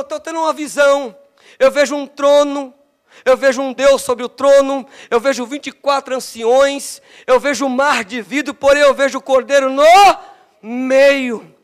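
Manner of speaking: loud, rising proclamation of the vision; preaching at full force. Acted. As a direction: preach it out like a revival sermon. Preaching John's vision loudly. (pregação inflamada e forte)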